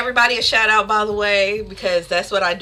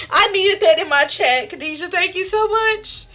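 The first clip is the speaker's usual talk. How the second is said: excited thanks; 8 semitones higher than usual. Real, not acted. Thanks viewer after cracking up.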